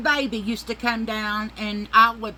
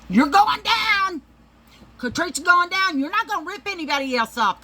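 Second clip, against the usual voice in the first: furious threat; 11 semitones higher, 4 dB louder than usual. Real, not acted. Threatening the seller Catrice who owes her money, high loud taunt.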